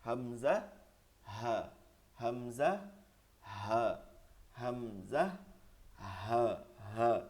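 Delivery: measured letter drill, হা dropping low; deep solemn voice. Shown as a demonstration. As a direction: recite gravely, sinking on each হা. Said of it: "এই দুইটা গম্ভীর আওয়াজ করতে হবে".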